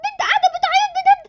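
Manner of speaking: high, stuttering in fear; shaky frightened stammer; terrified stammer. Acted. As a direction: stumble over words, frozen with fright. Stammering at the ghost girl.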